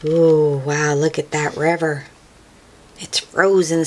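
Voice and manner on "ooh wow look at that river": usual speaking voice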